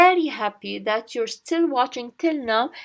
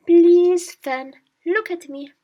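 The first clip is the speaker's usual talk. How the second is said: loud, high "please", dropping after; acted appeal to a friend; 6 semitones higher, 7 dB louder than usual. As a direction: implore a friend to pay attention. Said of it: "You tell him".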